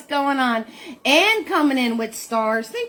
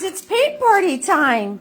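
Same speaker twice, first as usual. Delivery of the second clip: high dog-talk voice; 6 semitones higher, slower than usual. Real, not acted. Owner cooing to her excited dog Butters, high baby talk.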